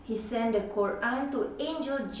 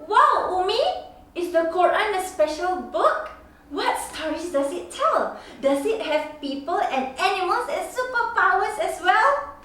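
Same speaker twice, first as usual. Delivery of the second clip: put-on higher, louder excited child voice; 6 semitones higher, 5 dB louder than usual. Child thrilled by the stories, asking mom with a higher, louder voice. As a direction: bubble over with a kid's wonder.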